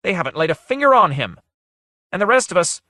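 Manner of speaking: high, loud furious outburst; rising fury, cut off; furious rise. Acted. Missus Kime raging about Ridolf, loud and high. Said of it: "Her voice rose furiously".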